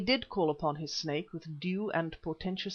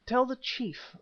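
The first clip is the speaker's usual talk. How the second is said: raised pitch, with emphasis on "chief"; stress on "chief"; 7 semitones higher than usual. A narrator's reading. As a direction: lay deliberate weight on the word "chief". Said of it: "he laid an emphasis on the word chief".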